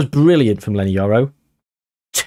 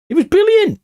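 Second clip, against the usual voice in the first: much higher, thrilled exclamation; excited praise. Real, not acted. Gamer thrilled by his team's move, high excited praise. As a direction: burst out in delight.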